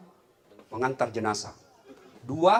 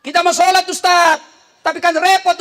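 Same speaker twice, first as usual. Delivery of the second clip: higher, louder pleading congregant; congregant asking; 12 semitones higher, 10 dB louder than usual. Acted. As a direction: whine it like a worried worshipper. Jamaah bertanya kepada ustaz. (jamaah mengeluh dengan suara tinggi)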